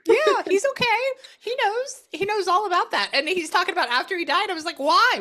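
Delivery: bursting with the spirit's news; high, loud excited reading. Real, not acted. Excited relay of spirit message.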